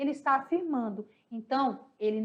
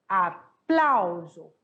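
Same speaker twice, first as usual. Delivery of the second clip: Aplauso drawn out slowly, syllable by syllable, leaning on plau; stress on PLÁ; 5 semitones lower, 4 dB louder, much slower than usual. Shown as a demonstration. Teacher spelling out Aplauso slowly so the class hears the stressed plau. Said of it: "No plá".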